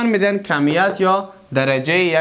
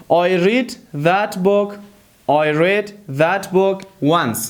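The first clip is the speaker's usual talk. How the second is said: very slow, word by word; slow dictation; much slower than usual. Shown as a demonstration. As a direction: dictate the example to learners. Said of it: "teacher models slow English".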